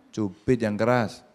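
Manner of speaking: slow, deliberate command; a teacher's slow, insistent instruction; slow firm order. Retold or imitated. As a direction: spell the order out slowly. Teacher repeating order to pinch.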